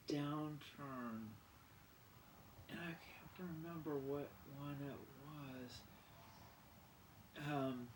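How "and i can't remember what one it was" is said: whispered aside. Real, not acted. Forgetting a designer's name, whispered aside. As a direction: whisper it to yourself.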